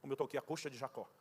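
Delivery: God's voice answering the complaining player, low and solemn. Retold or imitated. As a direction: speak quietly from above, grave and calm. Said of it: "Aquela voz disse".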